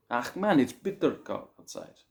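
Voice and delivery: put-on a light Afrikaans accent, grumbling about the cold; South African grumble. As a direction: moan "ach man" like a local on a cold day. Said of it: "if it's very cold".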